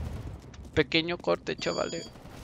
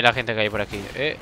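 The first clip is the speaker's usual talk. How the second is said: normal talking voice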